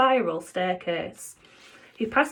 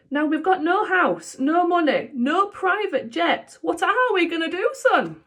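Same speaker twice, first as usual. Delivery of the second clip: high, loud fretful father; worried dad; 10 semitones higher, 4 dB louder than usual. Acted. Broke dad asking his son what now, high and worried. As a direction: fret aloud, high.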